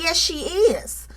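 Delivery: mock Tisha, slow and firm; flat retort. Tisha shutting Stormy down, slow and firm. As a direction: drag out yes she is, slow. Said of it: "Tisha was like".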